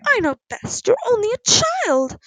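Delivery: high-pitched, indignant disbelief; aghast. A narrator's reading. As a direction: exclaim as a scandalised mother. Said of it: "Missus Pendle said aghast".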